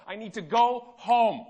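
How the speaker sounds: begging to get home after a death; desperate plea. Retold or imitated. Grieving man pleads with the airport agent, slow and desperate.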